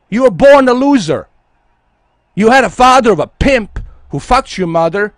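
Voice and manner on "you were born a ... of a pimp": yelled insult, pitched up and loud; angry shout. Real, not acted. Streamer yelling an insult at his chat, furious. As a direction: bark the insult at them.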